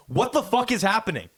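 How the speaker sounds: high, exasperated disbelief; high-voiced bewildered outburst; retold panic. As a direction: pitch it up in mock panic. Retold panic after second plunger breaks.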